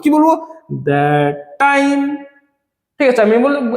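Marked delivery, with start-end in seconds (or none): 0.7-2.2 "That time" drawn out in a low, level voice; scansion reading. Shown as a demonstration. Teacher reading the line carefully for scansion, drawn-out syllables. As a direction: stretch "That time" slowly, syllable by syllable. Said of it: "আমরা পড়বো খুব ভালো মতো".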